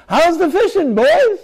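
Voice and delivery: high, loud jovial shout from the beach; hearty fisherman's call. Retold or imitated. Bystander on the beach teasing empty-handed fishermen, hearty call. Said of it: "somebody says".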